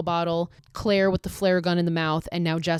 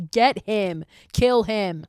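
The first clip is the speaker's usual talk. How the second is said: loud egging on; cheering; 3 semitones higher, 6 dB louder, much slower than usual. Real, not acted. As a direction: cheer her on, fired up. Cheering on a character.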